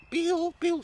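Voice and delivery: high, loud imitated call to her husband; mum calling out. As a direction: holler for him in a shrieky mum voice. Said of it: "she's calling my father".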